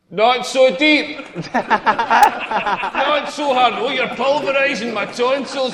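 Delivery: high, drawn-out hammed-up protest; mock overwhelmed lover. Lover flattering the comedian in bed, mock overwhelmed. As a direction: exaggerate every word as if overpowered. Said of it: "I wanna hear more reassuring stuff".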